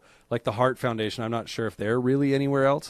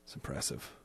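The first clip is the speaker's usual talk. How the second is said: whisper; 12 semitones lower, 17 dB quieter than usual. Real, not acted. Whispered aside, unvoiced.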